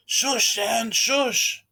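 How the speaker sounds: loud, high, angry hushing; furious shush. Acted. Annoyed roommate furiously shushing a screaming girl. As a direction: snap at her to shut up.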